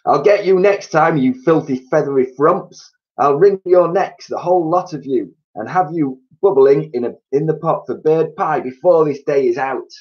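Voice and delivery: high, loud angry ranting; furious threats. A narrator's reading. Mister Twit raging at the birds on the roof. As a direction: threaten them with rage.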